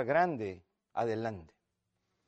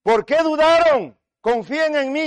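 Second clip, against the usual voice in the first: loud, high, stretched-out cry; raised, commanding preaching voice; preacher's booming rebuke; 10 semitones higher, 9 dB louder than usual. Acted. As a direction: call it out to the congregation with authority.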